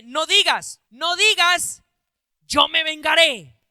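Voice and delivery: loud stern preacher warning. Acted. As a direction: call it out firmly to the congregation. Preacher warns against revenge.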